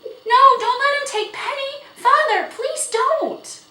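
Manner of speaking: very high, loud pleading; desperate cry. A narrator's reading. Girl imploring father to save the horse, crying out. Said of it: "cried Felicity".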